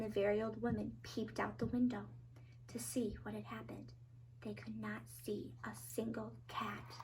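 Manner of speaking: near-whispered narration; very soft, secretive storytelling; hushed suspense. A narrator's reading. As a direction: drop to a breath, as if peeping out. Hushed suspense after the noise stops.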